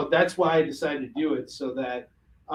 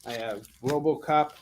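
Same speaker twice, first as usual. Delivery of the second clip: ordinary talk, nothing marked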